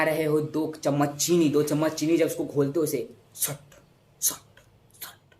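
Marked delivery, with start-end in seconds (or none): 3.3-5.3 hushed, toneless stirring noise; soft little swishes, spaced apart; stirring sound imitation. Shown as a demonstration. Teacher miming sugar stirred into water, hushed sound effect.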